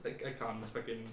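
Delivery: usual speaking voice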